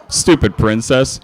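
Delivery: ordinary talk, nothing marked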